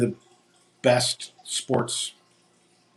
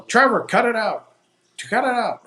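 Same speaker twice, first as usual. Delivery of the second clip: louder, higher yell of "Trevor, cut it out"; a father yelling at his son across the rink; retold yell; 12 semitones higher, 6 dB louder than usual. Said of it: "I kept hearing him yell".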